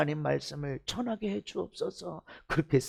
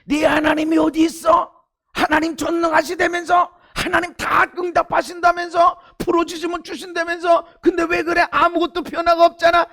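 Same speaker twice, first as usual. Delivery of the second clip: mocking taunt; 6 semitones higher, 5 dB louder than usual. Preacher voicing a mocking challenger.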